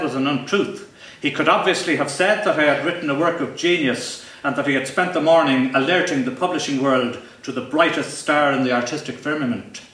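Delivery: ordinary delivery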